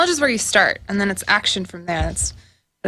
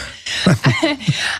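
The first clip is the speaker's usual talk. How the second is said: laughing; 3 semitones higher than usual. Real, not acted.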